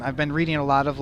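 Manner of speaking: ordinary talk, nothing marked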